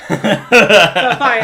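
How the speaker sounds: laughing. Real, not acted. Laughing through the banter.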